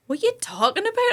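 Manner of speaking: half-asleep husband can't believe what he hears; baffled husband. Retold or imitated. Husband woken by phone call, baffled high shout. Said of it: "he was like".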